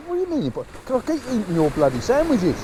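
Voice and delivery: high-pitched mock indignation; bantering retold exchange. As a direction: play up a comic argument, pitched high. Acting out the baffled exchange.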